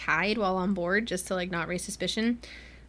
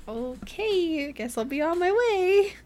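high, awkward retreat; awkward exit; 8 semitones higher than usual. Retold or imitated. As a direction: back away sheepishly. Awkwardly leaving after rebuff.

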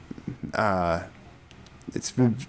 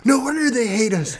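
much higher, freaked-out realisation; mock panic; 11 semitones higher than usual. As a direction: ham up a guy panicking in church. Retelling Seth Rogen movie scene.

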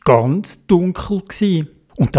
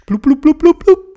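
bubbling sounds; 11 semitones higher, 4 dB louder than usual. A narrator's reading. Bubbles rising underwater.

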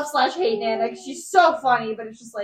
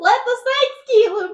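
put-on southern Nana accent; 9 semitones higher than usual. Southern granny Nana scolding about dead mice. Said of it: "When did Nana have that accent".

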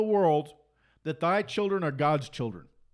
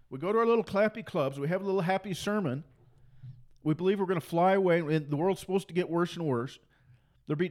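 ordinary talk, nothing marked

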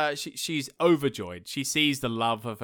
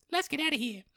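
high-voiced wife; 12 semitones higher, 4 dB quieter, faster than usual. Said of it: "she's like".

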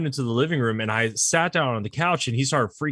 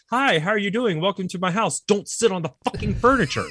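raised-pitch mock welcome, then the warning; mock host; 8 semitones higher than usual. Host greeting a guest at the door, mock polite. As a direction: ham up a fake polite host.

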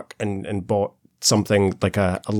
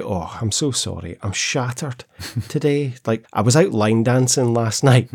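tired, chatty Glasgow woman; lightly voiced Scottish check-in lady; broad Scottish accent. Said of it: "she's like".

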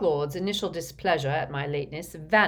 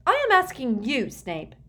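high, louder interruption dropping on the name; heated, raised-voice demand; 9 semitones higher, 6 dB louder than usual. A narrator's reading. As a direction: insist on an answer angrily. Bellatrix cuts in, demanding answer.

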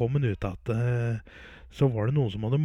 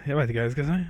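ordinary talk, nothing marked

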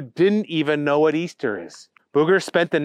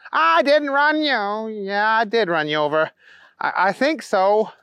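flustered Booger; 7 semitones higher than usual. A narrator's reading. Booger admitting he ran the bunny over, flustered high voice. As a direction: start high and ease down into admitting it.

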